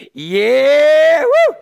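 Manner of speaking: excited cheer. Retold or imitated. Driver gloating as a speeder gets pulled over, whooping cheer. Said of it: "and you're like".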